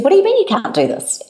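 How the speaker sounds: shocked disbelief. Retold or imitated. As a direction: echo her stunned disbelief with a high pitch. Director's shocked retort retold.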